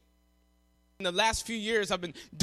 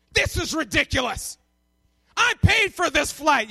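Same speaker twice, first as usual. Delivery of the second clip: irate passenger; 3 semitones higher, 4 dB louder than usual. Retold or imitated. Stranded passenger complaining about his paid flight, irate. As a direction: punch out an angry customer's complaint. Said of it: "he is getting irate".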